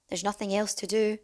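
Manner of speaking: ordinary delivery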